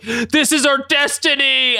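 high, drawn-out mock-epic proclamation; melodramatic declaration. Retelling the fighters' line from the parking garage, mock-dramatic. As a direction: stretch it out high, like cartoon melodrama.

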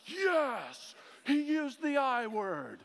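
high, drawn-out mock cheer; hammed-up elation. Reformed people hearing the I word, mock elation. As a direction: stretch it out high in delighted approval. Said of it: "All my Reformed people are like".

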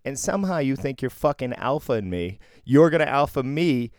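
indignant, punching out the accusations; still angry. Real, not acted. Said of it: "It still makes me angry".

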